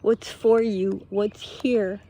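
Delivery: ordinary talk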